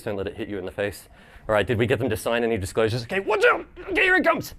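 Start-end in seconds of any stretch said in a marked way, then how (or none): 3.0-4.5 high warning call to the crowd. Real, not acted. Presenter warning audience as plush flies, high call. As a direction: shout a heads-up as it flies.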